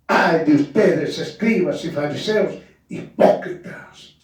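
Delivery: ordinary delivery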